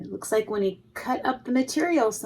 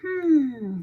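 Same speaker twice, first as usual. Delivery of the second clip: thoughtful hmm; 6 semitones higher than usual. A narrator's reading. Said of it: "he said".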